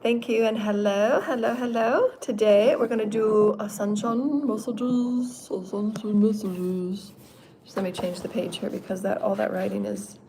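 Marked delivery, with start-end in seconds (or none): 0.0-7.1 slow, drawn-out greeting voice; slow show opening. Acted. She opens her channeling show, slow and drawn-out. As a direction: welcome the viewers in a slow sing-song.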